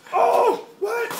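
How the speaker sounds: loud, high startled yell; surprised shout. Real, not acted. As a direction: shout in sudden shock. Shocked by sudden event.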